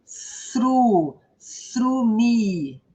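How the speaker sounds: "sru" and "srumi" with drawn-out vowels; teacher modelling a long, falling "srumi"; long vowel demo. Shown as a demonstration. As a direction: stretch the u after a blown th in "sru", then run on into "srumi". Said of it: "É longo".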